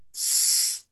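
soft, voiceless hissed "S" on its own; teeth-together s. Shown as a demonstration. Teacher showing where the teeth meet, bare hissed "S". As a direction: hold "S" as a hiss through closed teeth. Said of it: "giginya ketemu dulu".

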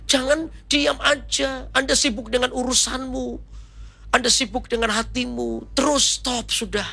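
high, insistent exhortation; forceful preaching. Real, not acted. Preacher challenges congregation to stop delaying, high insistent exhortation.

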